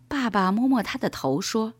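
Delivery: usual speaking voice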